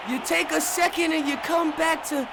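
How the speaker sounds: high, loud shout of disbelief; excited outcry. Real, not acted. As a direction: holler like the crowd just erupted. Interception right after a break.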